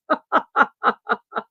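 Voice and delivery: high, breathy run of laughter; real giggling fit; hearty laugh. Real, not acted. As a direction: burst out laughing. Amused by truck stories.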